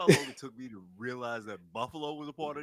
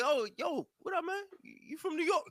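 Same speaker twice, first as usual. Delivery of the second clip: higher, eager, naive hello; friendly greeting; 13 semitones higher than usual. Retold or imitated. Newcomer greeting classmate on elevator, eager high voice. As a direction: chirp it like an excited newcomer. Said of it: "I'm like".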